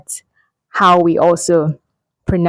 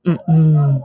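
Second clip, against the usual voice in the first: normal talking voice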